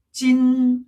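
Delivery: "찐" high, long and level; first tone. Shown as a demonstration. Teacher answering for this year, "찐" high level tone. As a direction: hold "찐" steady on a high note. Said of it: "일 성이니까".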